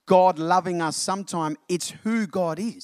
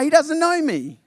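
brushing him off; 12 semitones higher, 3 dB louder than usual. Pretend. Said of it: "he's gonna be like".

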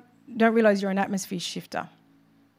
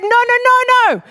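Jesus rejecting; 13 semitones higher, 7 dB louder than usual. Acted. Jesus voiced rejecting the complaint.

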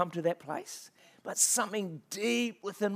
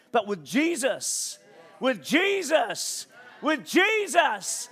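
Jesus drawn out, climbing higher with each repeat; building emphasis on Jesus; 4 semitones higher, much slower than usual. Real, not acted. Preacher crediting the couple's survival to Jesus, rising emphasis on Jesus. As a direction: stretch "Jesus" long, lifting the pitch every time.

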